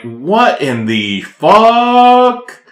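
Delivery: very slow, stretched-out disbelief; disbelieving. Retold or imitated. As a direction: drag every word out in shock. Retold disbelief at skull.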